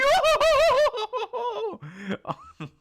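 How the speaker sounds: cackling at Brook's joke; high, loud burst of laughter. Real, not acted. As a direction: let out a delighted squeal of laughter.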